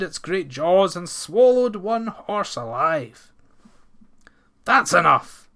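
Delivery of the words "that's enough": loud angry outburst; in rage. A narrator's reading. Ploughman losing temper at beast, angry loud outburst. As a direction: bellow it in fury. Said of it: "said Ranald in rage".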